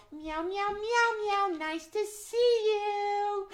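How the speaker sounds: very high, drawn-out cat voice; Max the cat. Acted. Cat answers the children's meows, high slow cat voice. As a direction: meow a long, high hello. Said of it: "Max the cat".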